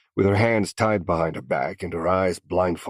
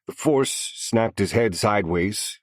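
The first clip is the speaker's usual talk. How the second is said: ordinary delivery, nothing marked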